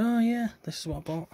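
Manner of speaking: imagined future self. Pretend. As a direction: play your future self recalling a purchase. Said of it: "look back at it in the future and go".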